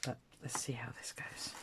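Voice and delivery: soft whisper to camera. Real, not acted. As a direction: whisper it as if not to disturb.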